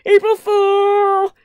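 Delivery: very high, drawn-out yell; gleeful shout. A narrator's reading. Cutting in on Phyllis with the joke, shouting. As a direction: shout it out long and loud. Others cut in over Phyllis.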